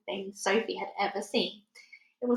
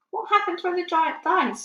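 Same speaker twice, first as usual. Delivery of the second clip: put-on much higher, a small girl correcting gently; little girl Sophie; 9 semitones higher, 4 dB louder than usual. A narrator's reading. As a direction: lift the voice high like a child. Sophie asking.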